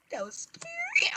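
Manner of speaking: tiny, very high squeal; scared squeak. Real, not acted. Gamer reacting to a scare, very high scared squeak. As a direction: squeak it softly in fright. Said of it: "I'm so scared right now".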